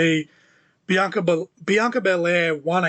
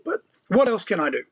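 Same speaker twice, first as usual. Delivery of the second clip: usual speaking voice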